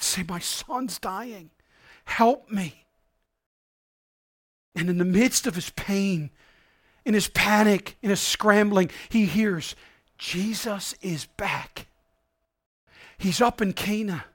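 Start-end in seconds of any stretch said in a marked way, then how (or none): 0.0-2.8 very soft, slow plea; a broken father begging quietly; hushed, slow desperate father. Acted. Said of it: "did he go to Herod himself and ask for help". 10.2-14.2 soft, slow rumour passed on; quiet, slow telling of the news; hushed news. Acted. News whispered to the father.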